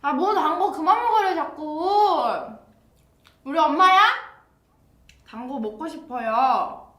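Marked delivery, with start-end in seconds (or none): 0.0-2.2 louder and higher, complaining playfully; loud protest. Real, not acted. Reacting loudly to a comment. 3.4-4.0 loud, high mock outrage; loud joking retort. Real, not acted. Joking protest at a fan.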